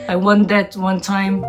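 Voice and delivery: normal talking voice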